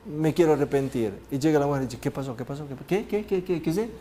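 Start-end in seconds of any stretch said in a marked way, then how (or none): 2.0-3.9 soft, rapid repeated questions, climbing in pitch; anxious questioning woman. Acted. Woman pressing for the bad news, acted anxious soft questioning. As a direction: pester softly, rising with each "qué".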